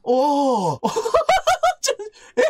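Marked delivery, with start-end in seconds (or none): 0.8-1.8 shrill laugh-cry. Real, not acted. Shocked by ghost's cry.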